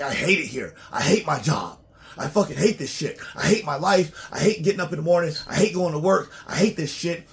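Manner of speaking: loud, rising, fed-up hate list; frustrated rant. Retold or imitated. Voicing a worker who hates his life, a growing angry rant. Acting out someone hating their job.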